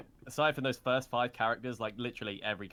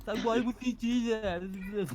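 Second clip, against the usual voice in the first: imitating dub voice; 10 semitones higher, slower than usual.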